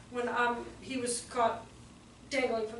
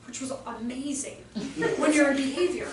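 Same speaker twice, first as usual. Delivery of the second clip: ordinary talk, nothing marked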